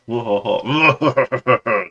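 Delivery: loud mock villain cackle; imitating a creepy guy's maniacal ho ho ho; maniacal laugh. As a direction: burst into a hammed-up evil laugh. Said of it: "he starts laughing maniacally".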